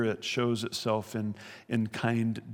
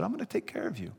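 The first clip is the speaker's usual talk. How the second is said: gentle, higher and softer, a little quick; softly promising to care for them, higher voice; spoke kindly; 6 semitones higher, 4 dB quieter, faster than usual. Retold or imitated. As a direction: reassure frightened brothers tenderly. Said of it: "He spoke kindly to them".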